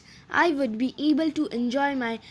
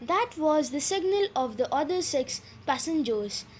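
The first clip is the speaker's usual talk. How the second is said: plain reading voice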